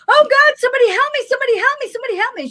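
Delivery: high, loud, fast pleading that fades at the end; frantic cry for help. Retold or imitated. Frantic mother on the street begging for help, high loud panic. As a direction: beg strangers for help, panicking. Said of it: "I'm hearing this woman going".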